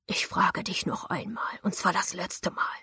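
slow menacing whisper; hissed threat. Acted. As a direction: hiss it low and slow. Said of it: "zischte er".

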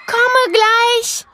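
calling across. A narrator's reading. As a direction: shout over to her friend.

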